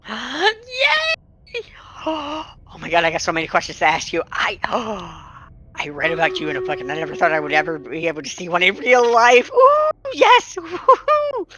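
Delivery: bouncing fangirl, gasping and racing, bursting into high squeals; Lil. Acted. Young fan finally meets her idol, bouncing with excitement. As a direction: rush the words breathlessly, then shriek with glee. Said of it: "she is literally bouncing".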